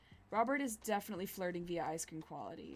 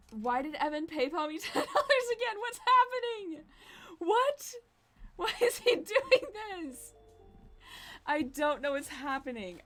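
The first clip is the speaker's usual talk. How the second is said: high, loud, laughing confusion; giddy stunned laughing; laughing disbelief; 8 semitones higher, 5 dB louder than usual. Real, not acted. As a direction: squeal through laughter at the surprise. Friend sends money again.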